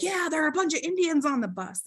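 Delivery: put-on high, quick childlike chorus; eager little kids all agreeing at once; kids chiming in. Siblings telling mom about the bus, high and quick. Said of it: "we were all like".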